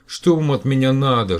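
a touch of weary pleading; plaintive. Acted. Tired professor pleads with the caller, plaintive. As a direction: plead to be left alone. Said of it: "жалобно произнёс Стаканский".

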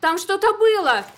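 nervous exclamation. A narrator's reading. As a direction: blurt it out on edge. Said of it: "Нервно воскликнула Кристина". (нервное восклицание)

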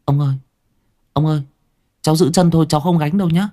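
a lightly voiced little boy pleading; child speaking softly. A narrator's reading. Boy telling his grandfather he'll only hold the feet, childlike. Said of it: "nó nói nhỏ".

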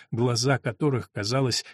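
usual speaking voice

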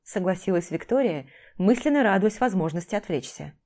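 ordinary delivery

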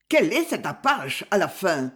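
plain reading voice

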